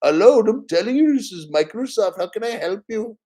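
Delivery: high, loud mock Indian accent; imitating the scammer's Indian accent; Indian call center accent. Mocking a scam caller from Microsoft in an Indian accent. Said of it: "some dude talking like Abu".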